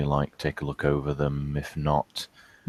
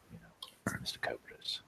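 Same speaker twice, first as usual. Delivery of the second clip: whispering; 3 semitones lower, 11 dB quieter than usual. Real, not acted. Whispered aside.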